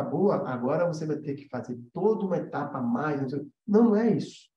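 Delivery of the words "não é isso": usual speaking voice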